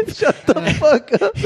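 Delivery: high-pitched, laughing through the words; helpless laughing comeback; laughing retort. Real, not acted. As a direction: crack up while telling a friend off. Laughing back at friend's joking rebuke.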